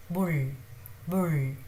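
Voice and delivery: bull with the b between M and B; pronunciation demo. Shown as a demonstration. Teacher repeating bull for students, careful. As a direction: model bull clearly. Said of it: "pronounced somewhere between M and B".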